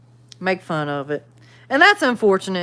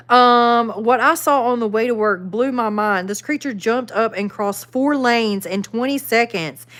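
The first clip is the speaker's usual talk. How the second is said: normal talking voice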